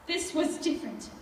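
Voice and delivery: ordinary talk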